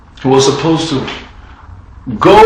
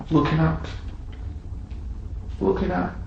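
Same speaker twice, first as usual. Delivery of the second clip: fading softly; 5 semitones higher, 11 dB quieter than usual. Acted. Repeated, fading.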